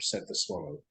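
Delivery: usual speaking voice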